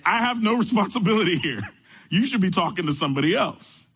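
laughing parody of a manager shrugging off blame; mock middle manager. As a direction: play the buck-passing middle manager with a laugh. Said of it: "This is the mantra of middle management".

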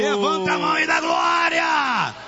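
preach. Real, not acted. Pastor calling church to praise, preaching shout. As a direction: shout it to the crowd, stretching the end.

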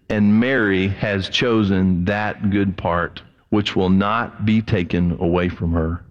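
slow, low tender reply; gentle Jesus. Acted. Reading Jesus' words gently.

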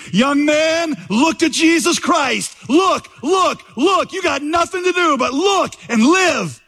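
very high, stretched-out imitation of a revival shout; preacher's shout. Preacher calling a young visitor to look to Christ, shouting. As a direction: cry out like a Primitive Methodist, high and urgent. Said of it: "he shouted, as only a primitive Methodist could do".

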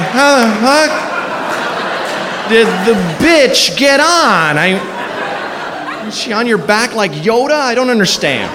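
high, laughing bewilderment; baffled onlookers through laughter; high puzzled giggly questions; confused laughing. Retold or imitated. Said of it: "Just so everyone's like".

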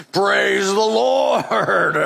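very slow, stretched proclamation; hammed-up pew response; drawn-out churchy cry; mock praise shout.

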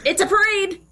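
high, delighted it's a parade; excited. Shown as a demonstration. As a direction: lift it up with delight. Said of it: "repeated with different emphasis".

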